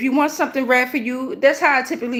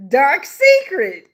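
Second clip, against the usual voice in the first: amused exclamation; 3 semitones higher, 4 dB louder, much slower than usual. Real, not acted. Amused by the incense name.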